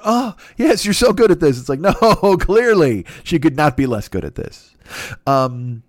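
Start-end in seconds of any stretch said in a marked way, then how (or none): 0.0-1.6 higher, put-on encouraging voice; higher-pitched imitation of an approving partner; mock pleasured praise. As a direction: play the partner faking enjoyment. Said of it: "And the other girl going".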